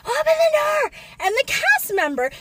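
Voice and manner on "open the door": high, urgent yell for help; pitched-up shout to be let out; calling for help. Retold or imitated. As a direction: pound the door and cry out. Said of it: "and we're like".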